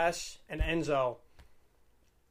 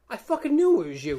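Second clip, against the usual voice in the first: higher, louder mock exclamation; excited recognition; 7 semitones higher, 7 dB louder than usual. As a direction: blurt it out delighted. Wrestler's retold excited recognition.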